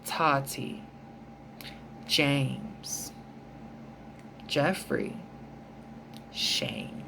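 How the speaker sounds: lightly mocking, each name let out in a low murmur; half-hearted mock gossip, murmured; mock hushed name-calling. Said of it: "be like".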